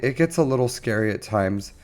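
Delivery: normal talking voice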